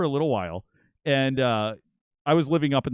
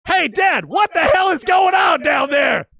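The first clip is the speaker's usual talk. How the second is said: high, loud, slow shout down the stairs; yell; 9 dB louder, slower than usual. Retold or imitated. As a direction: call out down to dad from the loft. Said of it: "I open the door and yell".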